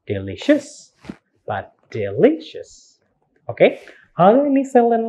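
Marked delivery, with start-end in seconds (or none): 0.1-0.9 "delicious" as deli-CIOUS; mis-stressed "delicious", weight on CIOUS; wrong last-syllable stress. Shown as a demonstration. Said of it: "Not the first, like delicious or". 1.9-3.0 "delicious" as de-LI-cious; de-LI-cious, middle syllable carrying it; correct second-syllable stress. Shown as a demonstration. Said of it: "Second syllable".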